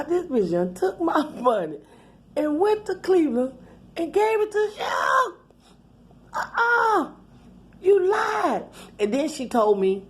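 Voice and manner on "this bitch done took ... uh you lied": building outrage. Retold or imitated.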